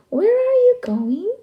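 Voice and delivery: very high, loud little fish. Acted. Fish asking the travellers, very high and loud. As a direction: squeak the question up high.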